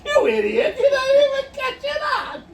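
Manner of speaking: high, loud laughing yell; laughing mock insult. Mocking a friend who missed the joke, laughing shout. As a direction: shout it through helpless laughter. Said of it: "makes me laugh more because I'm like".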